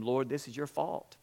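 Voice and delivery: softly reproachful, low and subdued; Mary and Martha complain quietly to Jesus, a hushed lament; quiet complaint. A narrator's reading. Martha to Jesus, hushed blaming complaint. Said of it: "they both complained to him".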